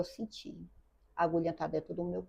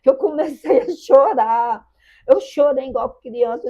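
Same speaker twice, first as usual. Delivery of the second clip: crying; 7 semitones higher, 12 dB louder than usual. Real, not acted. Chorando ao lembrar a crítica.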